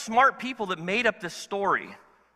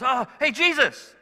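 eager Peter; 6 semitones higher, 6 dB louder than usual. Retold or imitated. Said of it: "And he goes".

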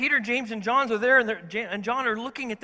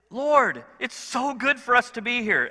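eager Peter; 5 semitones higher, 5 dB louder than usual. Retold or imitated. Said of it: "He just".

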